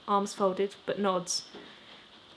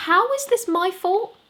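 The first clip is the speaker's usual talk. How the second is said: much higher, louder, slow mock outrage; faux shock; 11 semitones higher, 8 dB louder than usual. Lister mock-shocked at Rowan's blame, hammed-up outrage. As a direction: ham up being wronged. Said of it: "faux shock".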